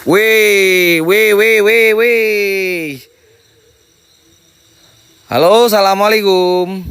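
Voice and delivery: loud high calls, drawn out slowly; startled shouting. Real, not acted. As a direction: shout at whatever is out there.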